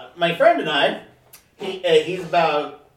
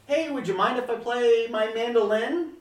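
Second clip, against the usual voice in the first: higher-pitched imitation of a casual stranger; casual polite request; 6 semitones higher, 4 dB quieter than usual. Street musician asking two diners if he may play, casually polite. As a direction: lightly imitate someone asking nicely, pitched up. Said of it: "just normal, normal, like".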